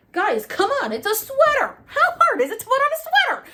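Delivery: loud, very high mock tantrum; exasperated outburst. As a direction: wail it like an overgrown baby losing patience. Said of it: "You wouldn't sit still".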